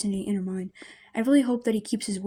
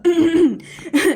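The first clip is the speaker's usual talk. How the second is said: flustered throat clear; 8 semitones higher, 12 dB louder than usual. Acted. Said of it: "clears his throat".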